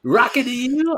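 very high-pitched announcer patter; mock radio DJ.

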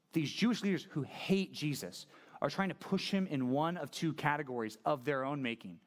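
usual speaking voice

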